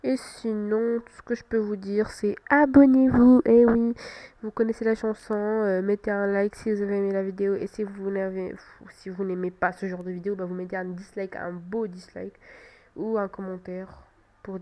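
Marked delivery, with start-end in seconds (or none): none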